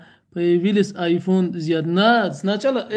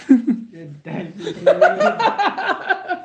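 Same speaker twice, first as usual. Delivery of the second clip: high laughter; 9 semitones higher than usual. Real, not acted. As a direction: burst out laughing. Big laugh at dead phone.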